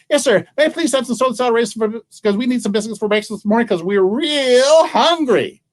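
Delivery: put-on high, rushed small-boy plea; eager little boy. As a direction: rattle it off high, stretching the end.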